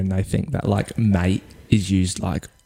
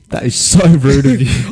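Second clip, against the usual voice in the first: mock outrage; 4 semitones higher, 6 dB louder, slower than usual.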